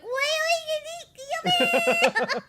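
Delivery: high, loud squeal breaking into laughter; mock-flustered high-pitched outburst; flustered reaction. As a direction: squeal it, thrown by the compliment. Acting out flustered reaction to compliment.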